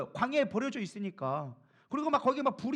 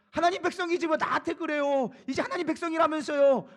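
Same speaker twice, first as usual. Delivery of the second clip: acted high-pitched, louder protest; indignant arguing; 4 semitones higher, 4 dB louder than usual. Preacher voices Moses quibbling with God, indignant arguing. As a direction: throw it back as an aggrieved complaint. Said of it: "하고 사실을 따지고 있는 거죠".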